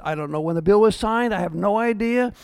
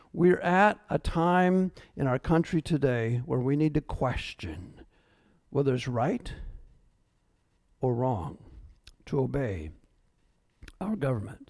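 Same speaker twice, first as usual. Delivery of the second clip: solemn hush; 7 semitones lower, 7 dB quieter than usual. Real, not acted. Pastor urges the church to question government, hushed and grave. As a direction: let each phrase fall quiet and grave.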